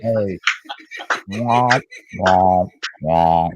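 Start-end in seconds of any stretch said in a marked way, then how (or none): 1.3-3.5 descending womps, stepping lower each time; spaced-out comic losing-horn noise; mock sad trombone. Mocking sad trombone at co-host.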